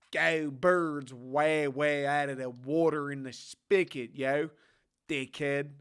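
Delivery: slow, exaggerated Philly accent; trash Philly accent. Shown as a demonstration. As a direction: lay the Philly accent on thick. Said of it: "Trash Philly accent".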